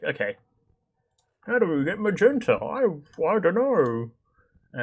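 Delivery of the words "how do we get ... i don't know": much higher, sing-song and drawn out; a silly high sing-song voice, pretending to be stumped; playful sing-song. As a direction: ham up the puzzled question like a cartoon. Repeats question in playful voice.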